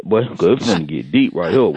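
hammed-up deep-talk voice; ominous warning.

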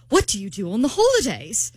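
passable imitation of a British date; amateur British quote; British accent. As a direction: ask it in a put-on British accent. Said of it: "That was good, by the way".

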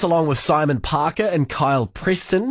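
ordinary talk

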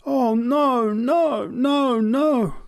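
high, loud despairing cry of no; a sick man crying out in despair; anguished cry. A narrator's reading. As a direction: wail it out in anguish. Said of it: "flung back his head and cried out".

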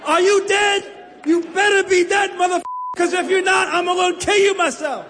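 high cursing yell at the corpse; cursing at the dead. Retold or imitated. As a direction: yell curses to chase off fear. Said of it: "You gotta curse the dead".

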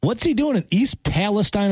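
wife's question. Retold or imitated. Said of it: "And she said".

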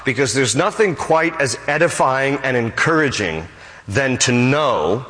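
ordinary delivery

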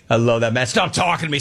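normal talking voice

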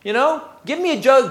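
normal talking voice

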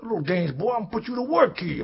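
high loud mock-gruff voice; loud father setting the boy to work; stern father. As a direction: scold the boy to get to work. Father scolds son.

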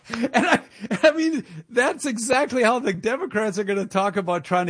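cracking up mid-sentence; laughing. Real, not acted. Host laughing at how Democrats will talk sports.

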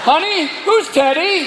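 high, slow, pointed question; suspicious husband. Acted. Husband questioning his wife after the parrot, slow and high. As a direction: draw it out with rising suspicion.

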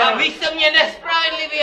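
shouting. Pretend. Shouting complaint in the sketch.

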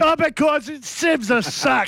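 high-pitched angry outburst; yelling. Real, not acted. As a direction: blurt the insult, wound up. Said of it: "stop yelling and screaming".

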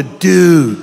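exaggerated California dude voice; laid-back surfer-style California accent. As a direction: play it as a mock California dude. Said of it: "Or California".

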